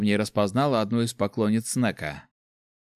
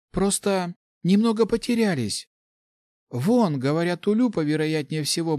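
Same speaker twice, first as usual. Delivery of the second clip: insistent protest; 7 semitones higher than usual. A narrator's reading. Insisting the missing friends are alive.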